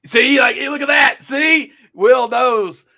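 high, loud, vindicated shout; excited calling out. Real, not acted. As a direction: call out loudly that you have proof. Excitedly points out live corroboration.

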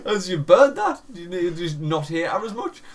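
high and loud, breaking into laughter; laughing disbelief. Real, not acted. Telling a gig mate off while laughing, high and loud. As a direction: crack up while telling him off. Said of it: "I was like".

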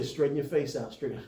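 quiet inner correction, low and hushed; the Holy Spirit checking him on a video call, low and soft; Holy Spirit's voice. Retold or imitated. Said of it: "I hear the Holy Spirit say".

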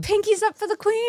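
mock posh British. Repeats mock British toast.

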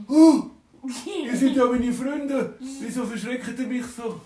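high and loud, startled pleading; a scared dwarf asking his friends why; frightened dwarf. Acted. (hoch, laut und erschrocken)

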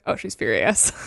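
deadpan aside. Retold or imitated. Fleabag to the camera about her sister, low and deadpan. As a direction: murmur the truth to the camera, dry. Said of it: "she goes".

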